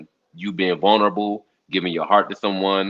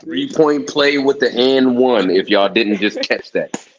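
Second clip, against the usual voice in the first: loud, higher hype call; excited call; 4 semitones higher, 4 dB louder than usual. Real, not acted. Host hyping a basketball play on TV, loud and excited. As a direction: shout out the play like a fan.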